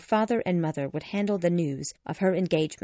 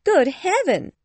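very high, loud outburst; overexcited mother crying out; excited exclamation; 12 semitones higher, 7 dB louder, slower than usual. A narrator's reading. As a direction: squeal it in giddy astonishment. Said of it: "she exclaimed".

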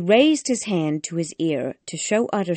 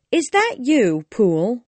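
higher, louder anxious woman behind the door; woman's voice; 6 semitones higher, 5 dB louder than usual. A narrator's reading. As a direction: call out as a worried maid through the door. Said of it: "Utterson heard a woman's voice ask".

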